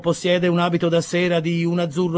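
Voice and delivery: high, loud servant calling through a door; a polite servant raising his voice to be heard; bootblack voice. Acted. Bootblack asking Pickwick through the door, high and loud.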